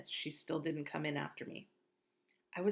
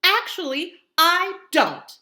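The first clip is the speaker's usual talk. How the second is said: loud, high quarrel between mother and daughter; shouting argument; 14 semitones higher, 15 dB louder than usual. Acted. Mother and teen daughter arguing, both shouting. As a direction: Mom and Via yell at each other. Mom and Via argue.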